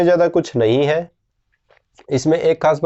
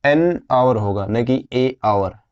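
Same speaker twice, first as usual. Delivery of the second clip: slow teacher contrast, right then wrong; slow correction; 3 semitones lower, slower than usual. Shown as a demonstration. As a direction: spell out एन आवर versus ए आवर slowly. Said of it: "इसको ऐसे बोलते हैं आवर". (धीरे-धीरे समझाते हुए सही और गलत)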